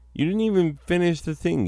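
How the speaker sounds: high, fast exasperated scolding. Acted. Scolding Gramps.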